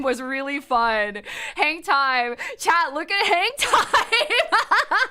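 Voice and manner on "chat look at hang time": laughing through the words, slow and high. Real, not acted. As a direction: giggle it out while pointing. Laughing at hang time ride.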